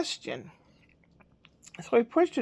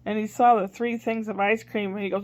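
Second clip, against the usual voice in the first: ordinary talk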